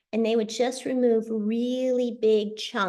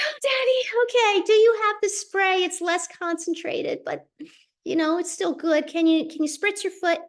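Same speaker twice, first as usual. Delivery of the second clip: high, urgent coaxing to her daddy; urgent pleading; 8 semitones higher than usual. Retold or imitated. Daughter on the phone urging her dad to treat his foot, high pleading. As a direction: plead with him, voice way up.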